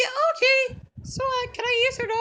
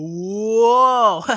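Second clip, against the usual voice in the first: long, low, even louder whoa; 13 semitones lower, 9 dB louder than usual. Retold or imitated. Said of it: "And I was like".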